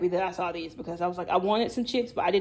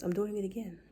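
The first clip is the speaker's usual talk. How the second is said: ordinary talk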